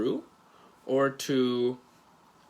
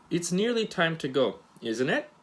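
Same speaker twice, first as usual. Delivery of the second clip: "isn't it" rising at the end; rising question tag; 4 semitones higher than usual. Shown as a demonstration. Teacher showing a real question, rising intonation. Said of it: "See the intonation?".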